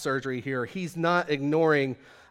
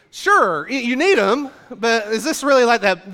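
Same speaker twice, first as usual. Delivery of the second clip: mock complaint; 5 semitones higher, 7 dB louder than usual. Socks at Christmas complaint.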